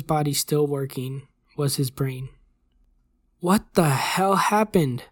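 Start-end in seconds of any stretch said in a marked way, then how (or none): 3.4-5.0 high, slow, emphatic outburst; shocked demand. A narrator's reading. Doctor barges in on agents, shocked demand. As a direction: demand an answer, stretching each word.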